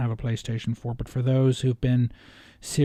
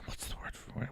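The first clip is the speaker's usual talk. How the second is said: softer whispered question to himself; whispered aside; 18 dB quieter than usual. Real, not acted. Gamer muttering to himself mid-review, soft whisper. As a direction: whisper it while hunting for the word. Searching for a word aloud.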